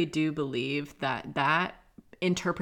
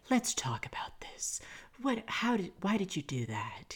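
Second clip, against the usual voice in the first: hushed, higher mock-soothing whisper; mock gentle whisper; 5 semitones higher, 4 dB quieter than usual. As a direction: whisper it like a fake therapist.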